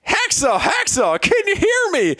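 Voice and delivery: very high, hammed-up frantic shouting; frantic calls. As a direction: call to a wounded comrade in mock panic. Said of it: "came the frantic calls from Dexon".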